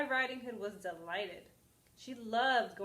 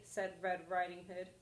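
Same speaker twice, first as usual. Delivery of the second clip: plain reading voice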